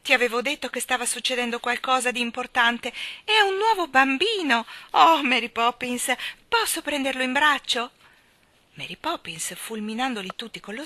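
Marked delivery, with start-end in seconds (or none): 0.0-7.9 put-on loud, high, gushing child; excited. A narrator's reading. Jane discovers the new baby, excited. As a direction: bubble over about the new baby. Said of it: "gridò Jane tutta emozionata".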